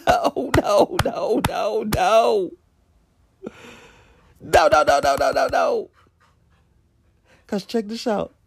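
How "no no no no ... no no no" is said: high string of noes; mock denial. Real, not acted. Protesting to viewers, high repeated noes. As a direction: keep protesting no.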